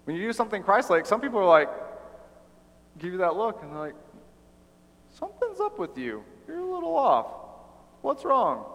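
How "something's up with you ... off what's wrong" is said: much higher, squeaky suspicious voice; a silly, suspicious high-voiced jab; high mocking voice of a skeptic; mocking onlooker. People mocking Christ-like behavior.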